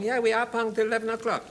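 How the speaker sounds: high mock Chinese accent; put-on Chinese comic voice. Acted Chinese reply in retold sketch.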